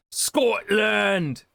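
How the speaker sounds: put-on loud, high-pitched shout in a shaky Scottish accent; attempted Scottish accent. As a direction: belt it out in a bad Scottish accent. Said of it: "I can't do a Scottish".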